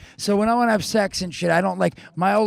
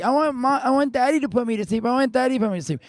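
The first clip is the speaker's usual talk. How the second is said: put-on whiny child; 6 semitones higher than usual. Child begging for daddy at bedtime.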